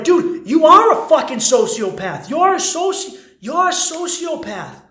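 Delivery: high, loud mock yell of disbelief; retold outraged yell. Commenters yelling at the proud guy, loud outraged mock yell. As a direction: shout it at him like he's lost his mind. People replying online, mocking outrage.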